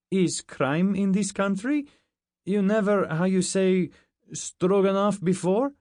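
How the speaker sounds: put-on high, loud incredulous Russian accent; disbelieving broken-English question; Russian soldier astonished at the commander. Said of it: "This one was Russian".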